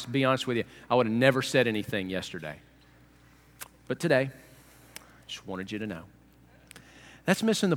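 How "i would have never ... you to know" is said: low false modesty, trailing softer; mock humblebrag. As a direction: casually let slip a pious boast, voice dropped. Humblebragging faster, mocked.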